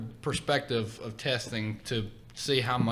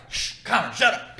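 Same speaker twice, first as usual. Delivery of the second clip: shushing classmate; 9 semitones higher, 4 dB louder than usual. Retold or imitated. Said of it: "I'm like".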